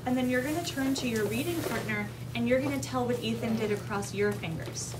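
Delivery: usual speaking voice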